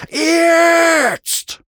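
longest, high, full-force scream. A narrator's reading. Mount's last wingbeat before impact, all-out scream. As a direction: scream it high and stretch it right out. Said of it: "schrie Mac".

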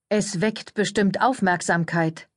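plain reading voice

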